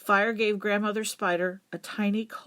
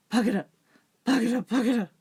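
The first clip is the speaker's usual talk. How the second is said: breathless panting chant; out of breath; 3 semitones higher, much faster than usual. A narrator's reading. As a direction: gasp the chant out, winded. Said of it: "out of breath".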